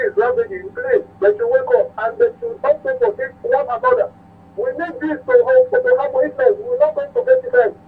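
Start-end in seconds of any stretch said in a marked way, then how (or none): none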